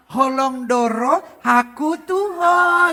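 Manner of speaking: slow, syrupy, sweetened Batak line; mock sweetened Batak love line; sweet drawn-out wrong way. Shown as a demonstration. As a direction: stretch it out sweetly, as if crooning. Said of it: "nggak bisa gini".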